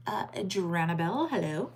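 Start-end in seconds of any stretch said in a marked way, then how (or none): none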